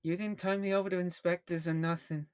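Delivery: put-on young newsie street accent; newsie kid accent. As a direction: talk like a newsboy, newsie accent. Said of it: "this child's accent is changing".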